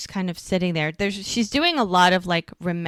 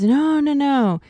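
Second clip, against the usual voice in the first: insistent refusal; 8 semitones higher, 8 dB louder, slower than usual. Retold or imitated. Acted refusal of a debt.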